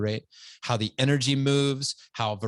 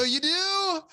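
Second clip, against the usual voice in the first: a much higher, startled "You do?"; surprised disbelief. Retold or imitated. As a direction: act caught off guard by sudden affection. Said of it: "And I go".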